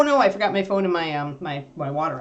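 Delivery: usual speaking voice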